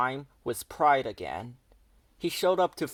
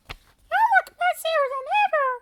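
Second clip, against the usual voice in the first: put-on high, loud girl voice; Violet's voice; 6 dB louder than usual. A narrator's reading. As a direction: tease him in a high girl voice. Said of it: "Violet said".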